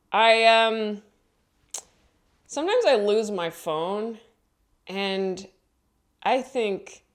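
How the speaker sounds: ordinary talk, nothing marked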